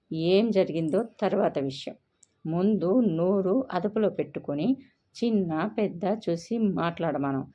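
ordinary delivery, nothing marked

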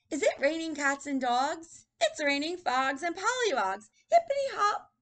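put-on high, loud sing-song kid, rising to a squeal; happy little boy. Teacher reads boy playing in rain, high and gleeful. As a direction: bounce along playfully. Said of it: "He's happy 'cause he gets to play".

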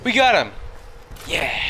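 high, loud victory shout; triumphant shout. Real, not acted. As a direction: yell it out in triumph. Enemy finally killed.

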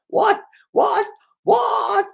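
very high, loud repeated yell; high-pitched retold shout; shouting over music. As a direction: re-enact yelling because you can't hear. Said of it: "because the music was so loud".

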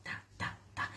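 unvoiced murmured syllables; soft rhythmic whisper. Real, not acted. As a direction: whisper the ta's under your breath. Whispered filler chant.

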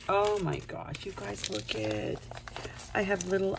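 normal talking voice

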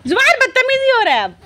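high, loud scolding; sharp scold. Real, not acted. Mother loudly scolding her misbehaving son.

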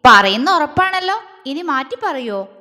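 sly teasing. Acted. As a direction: tease her with a crafty grin. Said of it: "അവൻ കള്ളച്ചിരിയോടെ ചോദിച്ചു".

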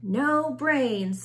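a zombie's loud, firm refusal; stubborn zombie moan; zombie insisting. A narrator's reading. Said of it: "said Reginald".

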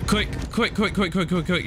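high, rapid-fire repeats; frantic urging. Real, not acted. Gamer with no time to mess around, high frantic urging. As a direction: hurry them along in a high panic. Racing against time in game.

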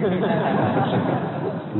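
a quiet amused laugh; gentle chuckle; soft laugh. Real, not acted. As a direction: laugh softly at the joke. Audience-facing laugh after joke.

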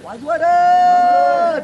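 long, stretched shout to the musicians; drawn-out shout of the cue; loud call. Real, not acted. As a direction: call out across the set for the music, slowly. Director calling for the music.

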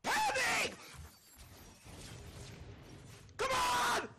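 high, loud gamer yell; yelling at the opponent mid-fight; shouting. Real, not acted.

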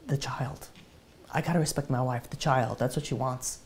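subdued, soft, halting surrender; barely able to speak. Retold or imitated. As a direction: say it softly, as if the words hardly come. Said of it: "he couldn't even say the words".

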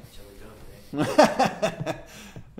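real burst of laughter; laughing. Real, not acted. Preacher amused by his Canadian slip, laughing. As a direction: laugh freely at your own slip.

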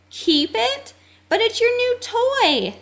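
high-pitched, incredulous question and objection; astonished protest. A narrator's reading. Cleo astonished at giving toy away.

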